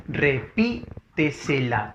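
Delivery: Repítesela slowly split as re-PÍ-te-se-la; syllable by syllable. Shown as a demonstration. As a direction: sound out Repítesela with pauses, leaning on PÍ. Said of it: "sería el silabente silabeo". (Repítesela silabeado despacio, con fuerza en PÍ)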